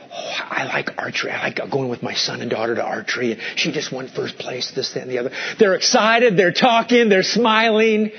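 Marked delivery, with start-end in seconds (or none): 0.1-5.3 soft whisper. Retold or imitated. Preachers chatting about their hobbies.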